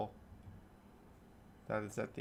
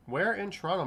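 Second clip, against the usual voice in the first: ordinary delivery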